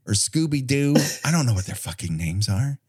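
normal talking voice

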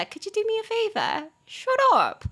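joking telling-off. Acted. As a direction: playfully silence a friend. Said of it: "我只是在开玩笑". (开玩笑地叫人闭嘴)

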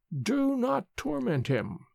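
put-on high, soft, slow old woman's voice; ancient nurse, hushed. A narrator's reading. Old nurse to the woman with the cup, soft and firm. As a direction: gently forbid it, frail and old.

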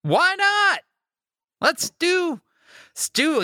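very high, sing-out forced cheer; trying to be up. Acted. Host after eleven months of not up, straining upbeat. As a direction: pitch it way up with dogged optimism. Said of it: "trying up".

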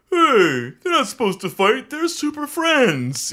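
high, drawn-out whiny parody of online fans; mock complainer. Host mimicking negative social media comments, high mock complaint. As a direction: whine it high, mocking their protest. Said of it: "people caving to all the negative social media comments".

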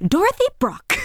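very high, timid voice; meek woman voice. Retold or imitated. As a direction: squeak it out like a meek teacher. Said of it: "she's this very meek woman".

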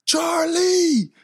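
high calling cry of a lost dog's name; a boy's high call down the street; kid yelling a name. Retold or imitated. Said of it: "he's yelling".

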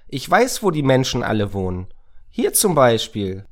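put-on high, loud, eager child; very excited. A narrator's reading. Moritz offers to show where everyone lives, very excited. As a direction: blurt it out, pitched right up. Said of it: "rief Moritz sehr aufgeregt".

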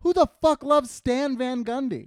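high, loud, drawn-out disbelief; slow, high-pitched comic incredulity; incredulous outburst. Retold or imitated. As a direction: exclaim it as if utterly baffled. Acted baffled reaction to excited fan.

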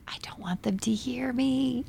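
slow, soft unvoiced whisper; creepy child whisper, slowly.

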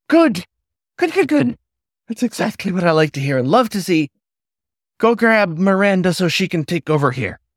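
very high, bouncing cheer; hyper delight. A narrator's reading. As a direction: clap and squeal it out. Hyper hare announcing.

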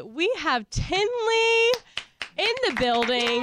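drawn-out high guest intro; announcer hype. Real, not acted.

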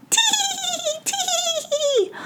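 very high squeaky tee-hee; tiny tittering pixies; pixie giggle. Acted. Pixies giggling from the tree, squeaky. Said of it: "pixie giggles filled the air".

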